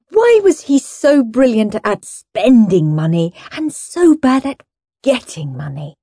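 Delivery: ordinary delivery, nothing marked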